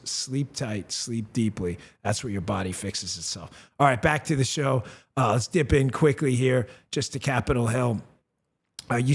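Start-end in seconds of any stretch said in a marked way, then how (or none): 0.1-3.7 soft soothing sign-off. Acted.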